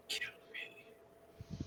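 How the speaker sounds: choked last-breath whisper, very soft; dying whisper. Acted. Creature begs with its last breath while being choked. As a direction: barely breathe the words out. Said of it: "it says".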